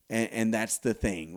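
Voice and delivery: ordinary talk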